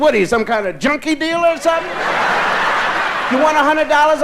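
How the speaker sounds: desperate for another pill; pleading outburst. Retold or imitated. Begging for more pills.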